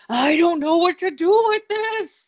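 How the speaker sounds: high, loud, over-the-top frantic cry; wired outburst. Retold or imitated. Co-host acting out the hopped-up dancing girl, frantic high yell. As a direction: act out her pent-up energy exploding, shouted high. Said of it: "she's just like".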